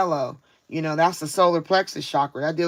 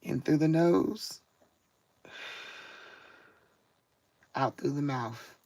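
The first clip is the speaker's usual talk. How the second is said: slow, soft breathing cue; guided breathing; 9 dB quieter than usual. Shown as a demonstration. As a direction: lead the listener through a calm breath, very softly. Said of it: "let's take a deep breath".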